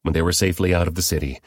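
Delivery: plain reading voice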